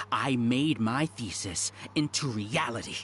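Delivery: ordinary delivery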